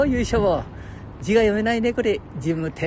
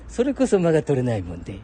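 ordinary delivery

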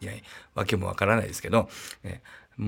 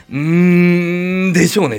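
loud, high, drawn-out celebrity drawl on the first word; Wakachiko impression; 7 semitones higher, 10 dB louder, slower than usual. After the negative result, mimicking Wakachiko, loud and high. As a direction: do the same star voice as later. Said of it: "また例の若智子みたいな感じで".

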